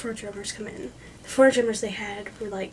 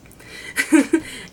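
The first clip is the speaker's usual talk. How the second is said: loud, higher burst of laughter; real laugh; 6 semitones higher, 6 dB louder than usual. Real, not acted. Admitting she has no soul voice, bursting into laughter.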